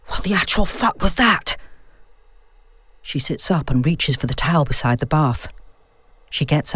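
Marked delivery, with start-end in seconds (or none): 0.1-1.6 shaken, raised disbelief; a fright just after surfacing; startled shock. Acted. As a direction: blurt it out, rattled. Just saw figure in bath.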